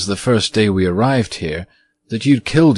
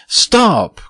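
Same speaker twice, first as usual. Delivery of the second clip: sharp high cry of stop; young girl stops the ghost with a high cry; girl's angry cry; 12 semitones higher, 4 dB louder than usual. Acted. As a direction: cry out, stamping her foot. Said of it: "cried Virginia, stamping her foot".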